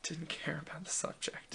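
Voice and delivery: soft whispered confession; whispered aside. Retold or imitated. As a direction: drop to a whisper for the admission. Confesses in retold quote.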